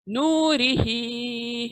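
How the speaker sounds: नूरिही with नू and ही long, री quick; slow tajweed reading of नूरिही; drawn-out recitation. Shown as a demonstration. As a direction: stretch नू and ही in नूरिही. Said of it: "ह को खींचना है".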